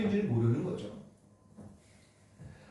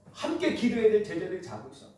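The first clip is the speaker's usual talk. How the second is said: usual speaking voice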